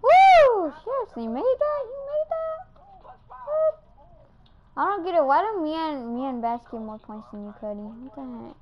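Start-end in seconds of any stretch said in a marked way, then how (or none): 0.0-0.7 high, loud "woo"; cheering whoop. Real, not acted. Player cheering a play, excited whoop. 0.8-3.8 very high, incredulous squeal; amazed disbelief. Real, not acted. Player to teammate who made the shot, astonished high voice.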